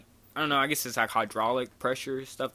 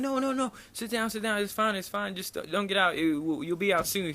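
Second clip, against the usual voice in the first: high-pitched, repetitive imitated reassurance; placating employee; 7 semitones higher than usual. Employees telling a boy to stay seated, mock urgent placating. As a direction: imitate a worker hurriedly talking a kid down, pitched up.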